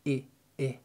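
ordinary delivery

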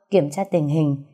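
plain reading voice

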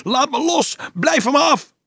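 very high, struggling yell; screaming. A narrator's reading. Dolf wrestling free from gripping hands, screaming. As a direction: scream while fighting off hands. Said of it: "worstelend en gillend".

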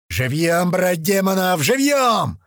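slow, drawn-out theatrical command, climbing high; sing-song rallying call with a high finish; hammed-up order. A narrator's reading. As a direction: stretch it out like a mock war cry. Crew member shouts a movie-quote order.

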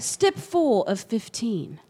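slow, mock British how-to narrator; lightly put-on British tutorial voice; British instructional voice. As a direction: read the step like a British tutorial. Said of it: "she talked like this".

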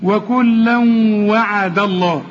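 وكلا وعد with a doubled, nasal held w; idgham into waw. Shown as a demonstration. Teacher shows waw as if doubled, وكلا وعد hummed together. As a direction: lean on the و of وعد. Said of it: "وكأن الواو عليها الشدة".

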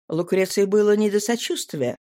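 ordinary delivery, nothing marked

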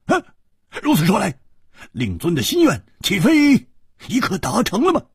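high, blurted in shock; a sudden high cry of alarm; startled outburst. A narrator's reading. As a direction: gasp it out, caught off guard. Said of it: "失声说".

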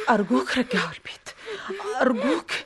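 soft breathy whisper, begging; pleading whisper. Acted. Mother in hospital whispering to her husband to take the boy home. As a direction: plead under your breath so no one hears.

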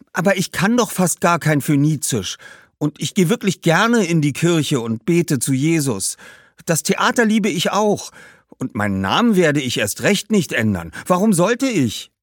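put-on high, protesting boy's voice; defiant young boy. Boy refuses the demands. (trotziger Junge, hohe Stimme)

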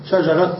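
"شجرة" with a sounded final t, "shajarat"; pausal t ending. Shown as a demonstration. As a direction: stop "شجرة" on t instead of h. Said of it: "سمع الوقف فيها بالتاء".

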